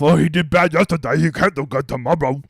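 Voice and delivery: whiny imitation of a golf bettor; mock overreacting fan. Mocking overreacting players, a whiny fan voice. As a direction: parody the fan who panics. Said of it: "why you talk like that".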